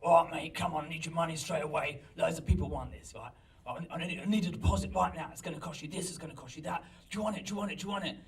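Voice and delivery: pushy cockney dealer's patter; cockney geezer. Retold or imitated. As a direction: badger them like a cockney market trader. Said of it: "this kind of cockney geezer".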